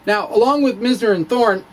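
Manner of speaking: ordinary delivery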